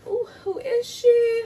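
high, sassy little aside; sassy admiring voice. Pretend. Playfully admiring herself in the mirror, high sassy voice.

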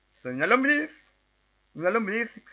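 high-pitched, rising silly voice; playful worm voice. Pretend. Gamer speaking as the worm, playful high voice. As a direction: lift it high as a little worm.

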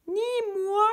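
miaulement très aigu; étirez le refus en miaulant; chat qui miaule. A narrator's reading. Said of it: "miaula le chat". (le chat paresseux refuse, miaulement très aigu)

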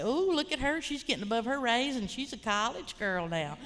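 swooping, exaggerated "ooh, look at her"; mocking family jibe. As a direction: tease her like disapproving kinfolk. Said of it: "my family would say".

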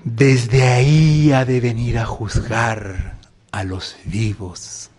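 slow, solemn recital fading to a whisper; creed recitation. Acted. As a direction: intone the creed reverently. Quoting the creed.

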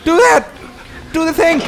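high panicked commands; frantic urging. Real, not acted. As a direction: urge yourself on in panic. Frantic while being chased.

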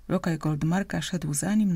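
ordinary delivery

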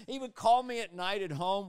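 normal talking voice

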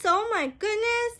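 ordinary delivery

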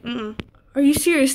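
normal talking voice

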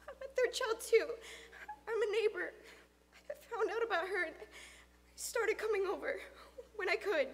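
put-on high, halting boy's voice; nervous boy. Third child explaining himself to friend's father, nervous. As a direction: explain yourself timidly, pitched high. Figure of Luke under questioning.

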